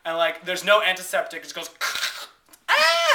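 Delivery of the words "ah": high-pitched imitated scream; screaming creature. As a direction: mimic a creature screaming in pain. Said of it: "the creature's screaming its head off".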